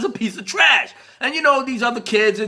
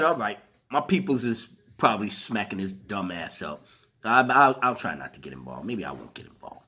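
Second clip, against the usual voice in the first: low, much softer inner voice; muttering to himself; 11 semitones lower, 6 dB quieter than usual. Retold or imitated. Retelling his own thoughts, muttered low and soft. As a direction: grumble it under your breath, lower and softer. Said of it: "And I said".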